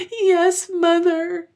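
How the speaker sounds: put-on loud, very high child wail; acted childish bawling; bitter sobbing. A narrator's reading. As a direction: sob bitterly like a little girl. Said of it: "sobbed more and more bitterly".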